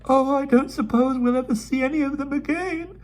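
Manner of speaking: put-on high, tearful, breaking girl's wail; sobbing. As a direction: burst into tears over leaving. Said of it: "she sobbed".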